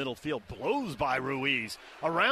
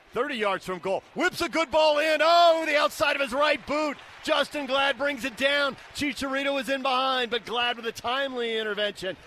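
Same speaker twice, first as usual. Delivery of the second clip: much higher and louder, urgent call; excited play-by-play; 7 semitones higher, 5 dB louder than usual. Real, not acted. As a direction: sell the big moment loud. Commentator calls a dangerous cross.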